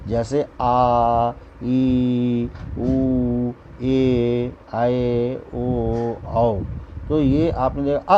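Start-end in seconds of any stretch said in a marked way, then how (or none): 0.6-6.6 each vowel आ ई उ ए आए ओ औ held slowly and evenly; long vowel demo. Shown as a demonstration. Teacher showing children how long vowels are held, slow and drawn out. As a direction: stretch every long vowel आ, ई, उ to double length, slowly. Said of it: "उसके दो गुने समय में बोला".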